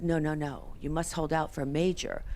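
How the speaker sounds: ordinary delivery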